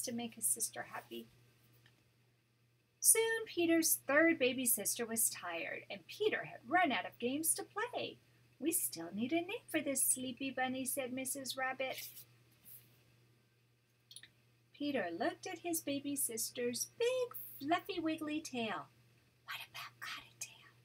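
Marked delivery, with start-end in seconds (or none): 3.0-18.9 gentle read-aloud storytelling voice; storybook narration for a grandchild; story narration. A narrator's reading. Grandma reads picture book aloud. 19.5-20.7 soft breathy whisper; whispered suggestion to his mother. Acted. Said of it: "he whispered".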